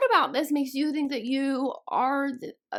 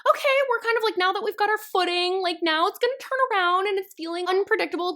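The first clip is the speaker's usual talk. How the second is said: a higher, mock-optimistic inner voice; mock hopeful; 8 semitones higher, faster than usual. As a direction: sound like you're talking yourself into it. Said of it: "So I was like".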